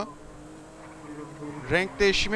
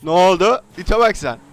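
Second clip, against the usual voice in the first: loud, higher alarmed questions; alarmed shouting; 6 semitones higher, 14 dB louder than usual. Real, not acted. Friend's tire just got shot, shouting in alarm.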